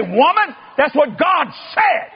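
high, drawn-out comic yell; exasperated mock shout. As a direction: holler back at her, stretching the words. Husband shouts back in quarrel.

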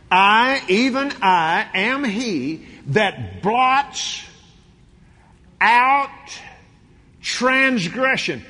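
loud, high, slow preaching; full-force pulpit proclamation. Acted. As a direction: drive each word home to the church.

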